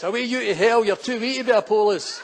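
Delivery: moderately loud dismissive yell; mock shout of scorn; retold shout. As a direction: imitate a woman sending someone packing. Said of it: "she shouts".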